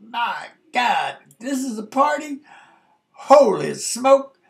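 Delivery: high, stretched-out holy smoke; a guy stunned by the size of the party; amazed disbelief. Retold or imitated. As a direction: gape at the chaos in wonder. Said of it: "I was like".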